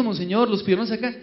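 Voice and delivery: ordinary talk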